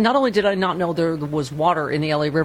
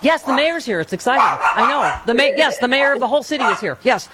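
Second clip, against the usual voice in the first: higher, quick excited pet talk; playful talk to dogs; 6 semitones higher, 4 dB louder than usual. Pretend. Host tells barking dogs the mayor is here, playfully excited. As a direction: humor the excited dogs in a raised voice.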